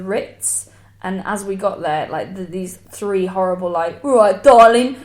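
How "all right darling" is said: loud Essex lad greeting, raised pitch; hammed-up Essex accent, much louder; mock Essex lad. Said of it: "like, Essex lads".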